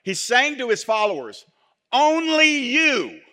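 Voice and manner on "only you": "Only" drawn out high and slow, "you" trailing; amplified emphasis. Shown as a demonstration. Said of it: "how it could be interpreted if we wanted to stretch it out and amplify it".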